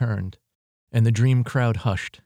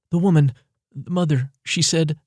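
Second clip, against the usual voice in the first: anxious rush; 7 semitones higher, 4 dB louder than usual. A narrator's reading. Ava blurts out the message.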